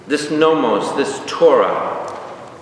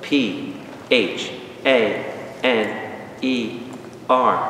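ordinary talk

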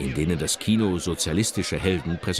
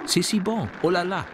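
higher-pitched quoted French exclamation; quoted call to audience; 8 semitones higher than usual. A narrator's reading. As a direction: lightly call it out, pitched well up. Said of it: "rief sie ins Publikum". (zitierter Ausruf ins Publikum, mit hoher Stimme)

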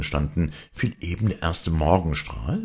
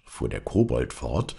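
plain reading voice

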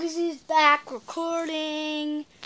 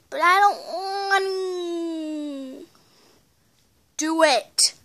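whiny; 4 semitones higher, 4 dB louder, slower than usual. Real, not acted. Child whining refusal.

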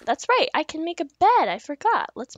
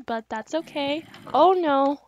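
ordinary delivery

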